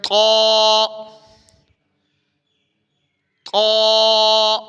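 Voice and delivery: thick طا, not تا; heavy short ṭā. Shown as a demonstration. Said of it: "موٹا پڑھنا ہے".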